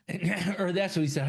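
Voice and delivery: ordinary talk, nothing marked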